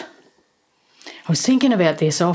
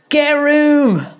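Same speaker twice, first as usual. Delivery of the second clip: high, loud mock-English call from the crowd; shouted heckle; 11 semitones higher, 6 dB louder, slower than usual. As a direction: yell it like a cheeky English heckler. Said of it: "several people shouting out".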